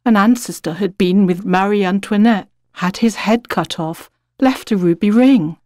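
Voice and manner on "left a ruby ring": plain reading voice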